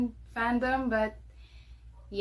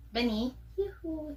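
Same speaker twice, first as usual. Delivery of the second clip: sing-song call to dog; 5 semitones higher, 4 dB quieter than usual. Real, not acted. Calling the dog sweetly.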